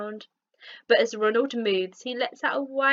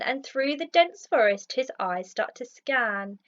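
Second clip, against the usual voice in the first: ordinary delivery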